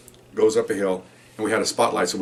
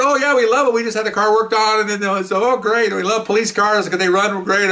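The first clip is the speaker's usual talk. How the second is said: put-on cheery old couple; 8 semitones higher than usual. Elderly owner tells the officer where they bought the car, higher and chirpy. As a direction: play the delighted elderly owner.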